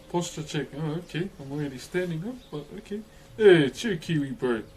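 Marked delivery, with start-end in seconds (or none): none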